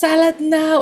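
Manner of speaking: high-pitched mock child's yell; imitating a toddler's scream. Daughter insisting on her salad, high mock yell. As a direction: shout it up high like an impatient little girl. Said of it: "She started to scream at me".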